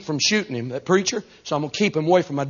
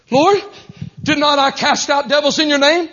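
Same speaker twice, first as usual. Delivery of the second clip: loud, high protest to the judge; desperate self-defence; 13 semitones higher, 5 dB louder than usual. Acted. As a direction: argue frantically for your soul. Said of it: "trying to convince the judge".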